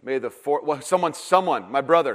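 normal talking voice

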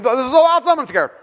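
loud, high gush that drops at the end; imitated scared excitement. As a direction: play a kid thrilled and frightened at once. Thrilled, scared son voiced.

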